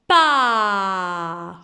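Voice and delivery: "pa" with a very long open a; wide-open mouth. Shown as a demonstration. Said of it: "on ouvre grand la bouche". (« pa » bouche grande ouverte, a tenu)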